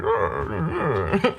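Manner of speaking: wordless garbled growling, imitating an actor; mush-mouthed growl. Host imitates actor's mumbling delivery, mush-mouthed growls. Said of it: "he's got mush mouth and he's just like".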